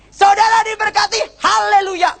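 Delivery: very high imitated preacher's shout; Gilbert blessing. Preacher blesses congregation, breaking the spell, high shouted blessing. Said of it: "Dia ngomong gini".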